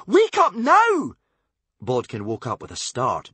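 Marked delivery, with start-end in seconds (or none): 0.1-1.2 slow, loud and high; shrieking. A narrator's reading. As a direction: shriek the order. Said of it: "shrieked Wish".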